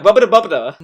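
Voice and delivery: bouncy fake-Italian gibberish; mock Italian accent. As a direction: parody how Italians talk, lightly. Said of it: "that's how Italians talk".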